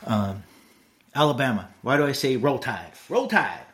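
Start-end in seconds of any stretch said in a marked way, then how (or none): 3.1-3.6 Southern accent cheer. Shown as a demonstration. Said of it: "You have to say it with a, somewhat of a Southern accent".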